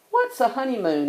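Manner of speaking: put-on light childlike question. A narrator's reading. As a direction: voice Brother as a curious little cub. Child cub asking a question.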